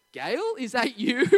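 slow, puzzled question; a touch of confusion, drawn out slowly. Retold or imitated. Coach at the door puzzled by mum's reply. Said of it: "his puzzled voice".

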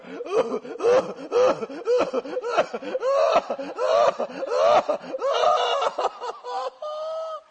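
long run of high, gasping sobs; mock heaving sobs. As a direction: imitate uncontrollable bawling, ending in a held wail. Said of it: "Oh, I was going".

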